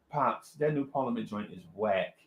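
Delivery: low, soft deadpan verdict; cool dismissal. Retold or imitated. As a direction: let the father down without any excitement. Kid flatly dismisses father's record.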